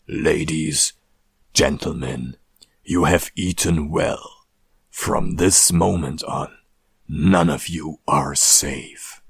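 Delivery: deep, slow sepulchral voice; grave voice. Retold or imitated. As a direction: ancient lich voice. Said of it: "mit Grabesstimme".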